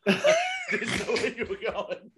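high laughing through the words. Real, not acted. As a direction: laugh while saying it. Laughing at friend's slip.

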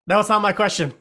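high, loud retraction; hurried protest. Real, not acted. Player stopping the gnome counting that question, loud protest. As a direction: blurt it out before it gets counted.

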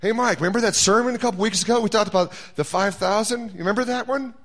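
God gently ribbing Mike about last time; God teasing. Acted. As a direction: tease him like a knowing friend.

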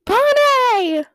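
high, loud mock cry of the name; imitating Elena's call. Fan imitating Elena calling for Bonnie. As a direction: call out like the actress. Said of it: "what she always says".

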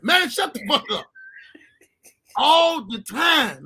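usual speaking voice

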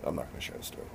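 mumbled under breath. Real, not acted. Mumbled backing out.

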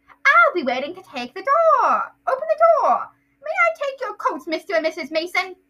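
put-on high, loud eager boy voice; Dudley. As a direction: recite it like a show-off kid. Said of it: "that's Dudley".

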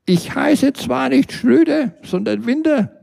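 a polite phone lady, faint and far off; Frau Winter. Acted. Phone operator answers over the loudspeaker, high and distant. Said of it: "drang es von weit her nach außen".

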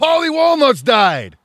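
loud, slow shocked shout; shocked outcry. Real, not acted. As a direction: blurt out the bad news, stunned. Shocked news of a death.

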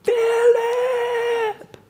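high, far-carrying shout of a name; dad calling out. Retold or imitated. Father spots his lost son and shouts his name. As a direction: call his name across the car park. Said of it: "The sound is this".

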